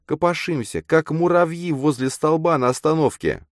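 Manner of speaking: put-on high, urgent girl's voice; pleading girl character. A narrator's reading. Girl begs her friend to hear her, pleading.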